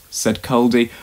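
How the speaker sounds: ordinary delivery, nothing marked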